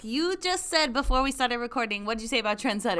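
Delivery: high, loud "hold up"; loud call-out. Real, not acted. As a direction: stop them mid-sentence. Calling out friend's contradiction.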